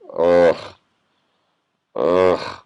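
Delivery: loud groan of disgust. Real, not acted. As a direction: groan like it physically hurts. Disgust at the show.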